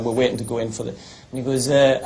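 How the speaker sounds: usual speaking voice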